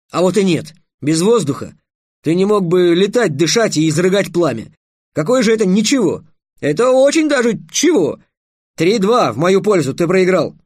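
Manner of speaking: high, loud victorious tirade; triumphant arguing. A narrator's reading. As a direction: pounce and press the win home. Trix triumphantly wins the contest.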